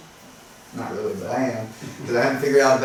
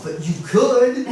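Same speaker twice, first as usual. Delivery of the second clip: high, slow, laughing tease; joking comeback; 9 semitones higher, 4 dB louder, slower than usual. Retold or imitated. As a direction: toss it back as a cheeky joke. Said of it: "So I just immediately turned it into a joke".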